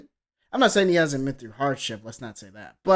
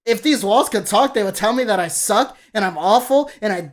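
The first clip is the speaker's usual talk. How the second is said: loud, higher recital building to an outburst; incredulous mock quoting; 4 semitones higher, 5 dB louder than usual. Critic quoting bleak lyrics to deniers, mockingly incredulous and loud. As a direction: throw the lyrics back in raised disbelief.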